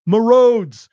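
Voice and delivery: loud indignant outburst; shout. Real, not acted. Ranting to his cohost about taxes, loud outburst. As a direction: yell it, outraged.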